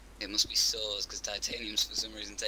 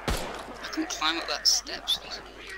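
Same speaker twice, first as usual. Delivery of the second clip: exaggerated northern English accent, much higher and drawn out; northern talk. Teammate mocks the stairs in a put-on northern accent. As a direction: ham up a broad northern accent. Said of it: "It's perfect northern talk".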